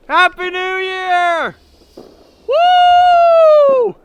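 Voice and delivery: high, drawn-out festive whoop; new year cheer. Real, not acted. Midnight fireworks cheer.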